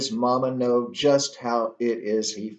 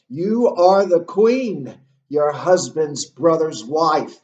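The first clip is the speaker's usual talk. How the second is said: loud, higher-pitched acted accusation; angry; 3 semitones higher, 6 dB louder than usual. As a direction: hurl each title at her in anger. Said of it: "the, the anger".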